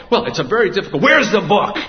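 demanding mother. Retold or imitated. Mother overriding the librarian's excuse, loud and high. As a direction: cut her off and demand it again.

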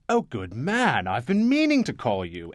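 imitating a posh Anglophile New Yorker on the phone; Long Island Lockjaw. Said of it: "he really did talk like that".

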